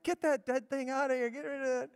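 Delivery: high, alarmed mother's yell; freaked-out mom. Retold or imitated. Mom spotting the rabbit head, freaked-out high yell. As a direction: shriek like a mom who found a dead rabbit. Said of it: "her mom saw it and freaked out and said".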